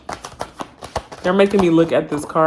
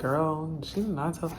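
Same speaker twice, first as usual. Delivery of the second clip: softer mock gossip aside; gossipy hush; 9 dB quieter than usual. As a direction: spirits gossiping about her, hushed. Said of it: "like business gossip".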